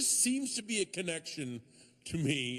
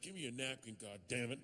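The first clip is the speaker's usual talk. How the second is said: low, much softer bitter grumble at the bar; grumbling drunk Seuss; 4 semitones lower, 8 dB quieter, faster than usual. Retold or imitated. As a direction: mutter it lower and softer, sore at being snubbed. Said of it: "and he's like".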